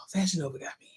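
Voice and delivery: soft whisper, like a guilty secret; hushed confession whisper; whispered aside. Shown as a demonstration. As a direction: whisper "Fashion Nova got me." to the viewers.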